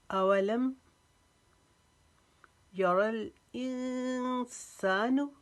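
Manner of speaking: high, slow, chanted verse with long pauses; full tajweed recitation. Shown as a demonstration. Teacher recites the whole verse for students in full tajweed. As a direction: recite the verse slowly, pausing between phrases. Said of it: "वक्फे के साथ पढ़ते हैं".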